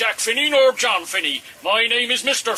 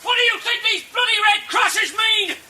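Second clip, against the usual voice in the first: furious shout; 13 semitones higher than usual. Acted. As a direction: scream at them in outrage. Stretcher bearer yelling at attackers.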